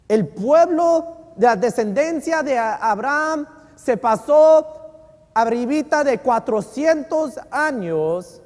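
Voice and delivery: loud, high, slow pulpit build to a stretched climax; preaching at full force. Acted. Preacher to his church about Egypt, loud, slow and high. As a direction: drive it home to the congregation.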